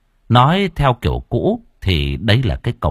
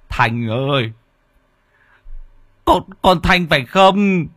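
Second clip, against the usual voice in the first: calling daughter; 7 semitones higher, slower than usual. Acted. Father calls to daughter's shadow.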